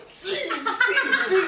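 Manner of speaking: eager yes. Retold or imitated. Agreeing to a fan's photo request, eager and laughing. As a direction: gush yes yes yes.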